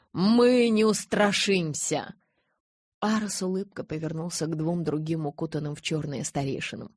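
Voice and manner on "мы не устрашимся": slow, raised pledge; solemn vow. A narrator's reading. Followers answering their leader, slow solemn vow. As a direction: vow it slowly and resolutely.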